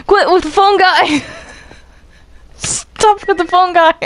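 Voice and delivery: high, laughing yell to quit it; half-laughing plea to stop; laughing protest. Real, not acted. Telling a friend to drop the phone guy, laughing.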